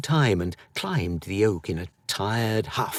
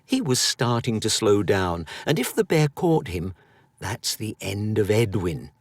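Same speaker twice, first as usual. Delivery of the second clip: plain reading voice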